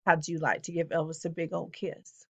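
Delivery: low, soft Tennessee [name] croon; [name] Tennessee accent. A narrator's reading. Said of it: "in his best Tennessee accent said".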